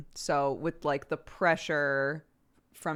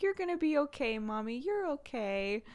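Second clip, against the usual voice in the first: put-on toddler comforting mom; 9 semitones higher than usual. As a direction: gently imitate a small child soothing mommy. Mom voicing toddler comforting her.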